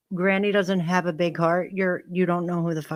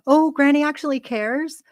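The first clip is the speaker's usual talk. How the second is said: higher, louder mock gushing; mocking quote; 8 semitones higher, 5 dB louder than usual. Mocking a rival who watched the clip, mild gushing. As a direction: sneer out her imagined thought.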